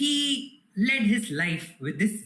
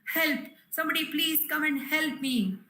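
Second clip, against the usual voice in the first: calling for help; 7 semitones higher than usual. Acted. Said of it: "he is trying to call for help".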